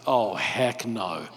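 mock low groan. Demetrius voiced, reacting to Paul.

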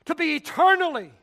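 loud, high preaching cry; fiery sermon shout; full-force preaching. Real, not acted. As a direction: thunder it from the pulpit.

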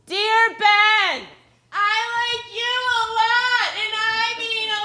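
high, whiny mocking girl voice; mock her in a whiny girly voice; whiny fakey girl voice. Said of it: "in a whiny, fakey girl voice".

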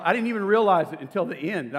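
usual speaking voice

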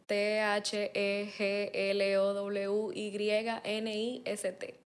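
slow spelling of "The Glowinist", letter by letter. Shown as a demonstration. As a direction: spell out T H E G L O W Y N E S T slowly, each letter separate. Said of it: "Deletréalo". (deletreando letra por letra, despacio)

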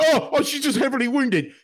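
high-pitched mock-naive fan; mock-concerned, on the edge of laughing; mocking denial. Mocks fans in denial.

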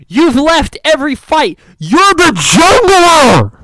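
loud, high angry rant at a useless jungler; retold rant. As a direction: yell at him like he's wrecking your game. Yelling at teammate in retold game.

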